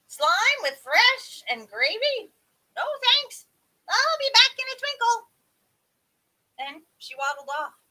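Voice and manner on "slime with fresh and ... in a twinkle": high, loud hard-of-hearing character voice; half-deaf fairy. A narrator's reading. Half-deaf fairy mishearing the king, half-deaf. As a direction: mishear it loudly, pitched way up. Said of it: "who was half deaf".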